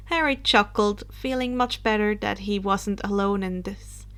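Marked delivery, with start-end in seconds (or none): none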